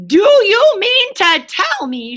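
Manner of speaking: high, loud, slow shout. A narrator's reading. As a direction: Rat shouts at Toad in disbelief. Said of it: "shouted Rat".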